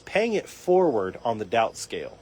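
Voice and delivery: ordinary delivery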